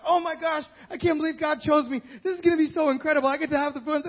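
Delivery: high, fast, giddy squeal of excitement; mock gushing joy. Mary as she did not react, giddy and high. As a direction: gush like a thrilled teen. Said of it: "jumping in joy like".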